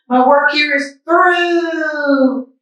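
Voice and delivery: high, slow, drawn-out farewell; wise old man voice. Shown as a demonstration. Mister Matu bidding farewell, slow high farewell. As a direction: say it slowly like a grand goodbye. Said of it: "I think he says it like this".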